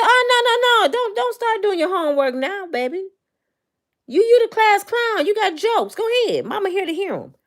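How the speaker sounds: high, sassy mother calling her son out; a mom daring her kid to keep joking; scolding mama. Retold or imitated. Mother scolding her son in class, high and sassy.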